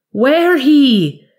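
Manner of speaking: a cornered warlock screaming hoarsely; cracked strained scream. A narrator's reading. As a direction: let the voice crack in terror. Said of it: "in a cracked and strained voice".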